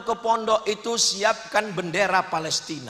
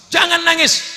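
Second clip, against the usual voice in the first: sudden high, loud order; raised-voice mock scolding; husband's command; 11 semitones higher, 7 dB louder than usual. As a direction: imitate a husband ordering his wife. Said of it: "Saya kasih tahu istri".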